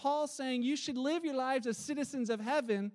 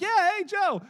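higher and louder friendly street call; calling out Hey Joe; 7 semitones higher, 6 dB louder than usual. Retold or imitated. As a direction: call across the road to a passing stranger.

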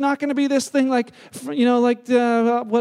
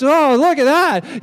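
distracted delight; 3 semitones higher, 9 dB louder, slower than usual. Mimics distracted walker.

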